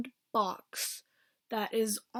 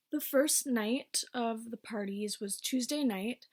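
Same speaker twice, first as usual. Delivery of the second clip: ordinary talk, nothing marked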